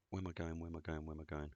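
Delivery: softly muttering the same question over and over; quiet puzzled self-talk, mostly under his breath; murmuring while he searches the map; muttered to himself. Real, not acted.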